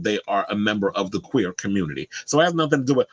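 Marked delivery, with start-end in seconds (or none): none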